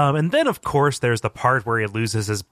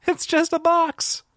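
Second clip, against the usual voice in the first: very high, slow put-on voice; hammed-up high catchphrase sign-off; put-on squeal; slower than usual. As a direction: stretch it out in a mock high-pitched cry.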